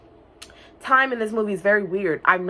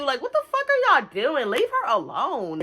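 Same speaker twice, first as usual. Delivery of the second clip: mock indignant beetle; 6 semitones higher than usual. Voicing the beetle.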